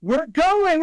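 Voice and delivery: high, loud thrilled announcement; a mother thrilled to announce the trip; excited squeal. Retold or imitated. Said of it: "she's like".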